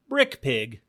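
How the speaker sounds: usual speaking voice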